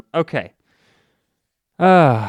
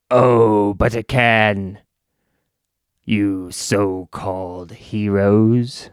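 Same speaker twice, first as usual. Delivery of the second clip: slow, lower sneering villain; mock villain taunt; 6 semitones lower, slower than usual. Villain sneering at the heroes, slow and mocking. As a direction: drawl the threat at the so-called heroes.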